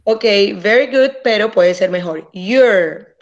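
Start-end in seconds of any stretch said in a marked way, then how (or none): 2.3-3.2 You're with the R clearly heard; You're ending on a strong R; sounded R. Shown as a demonstration. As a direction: make the R in You're ring. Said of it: "debe sonar la R".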